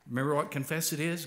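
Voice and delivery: ordinary delivery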